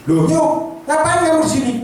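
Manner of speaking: startled outburst at the door; shocked shout of recognition; surprised exclamation. Retold or imitated. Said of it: "Langsung bilang gini".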